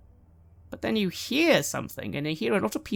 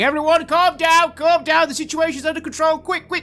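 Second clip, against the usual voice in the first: high, loud orders; commanding shout; 10 semitones higher, 7 dB louder than usual. Acted. Watchmen ordering panicking patrons to exits, loud. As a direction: bark orders at the crowd.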